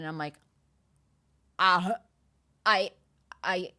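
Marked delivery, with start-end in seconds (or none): 1.6-3.7 halting stammer with long gaps; speechless. Retold or imitated. Client stunned by coach's ten million, speechless. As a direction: start and stall, lost for words. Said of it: "she just left me speechless".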